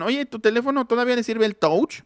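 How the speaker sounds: mock someone asking with a Hispanicized "tóuch"; imagined questioner. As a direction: ask it high, mispronouncing "touch" like "touch/tóuch". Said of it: "cuando les digan".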